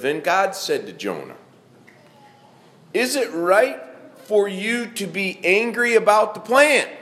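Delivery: measured, solemn Bible reading; scripture reading. Acted. Preacher reads the Jonah story to the congregation, solemn reading. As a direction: read the passage with weight to the congregation.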